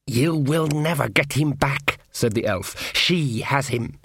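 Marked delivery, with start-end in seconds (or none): 0.1-2.0 slow, cold, taunting elf voice; sinister elf. Acted. 2.9-4.0 slow, cold, taunting elf voice; sinister elf. Acted.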